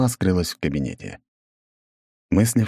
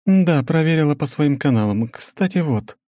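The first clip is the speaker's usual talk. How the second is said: measured, higher female voice; woman's voice; 9 semitones higher than usual. Acted. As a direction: narrator voicing a businesswoman, higher. Svetlana voiced.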